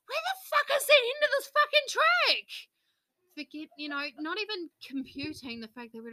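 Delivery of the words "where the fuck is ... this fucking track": high, loud furious yell; loud outraged complaint; exasperated yell. Retold or imitated. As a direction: yell at the endless track. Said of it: "I'm like".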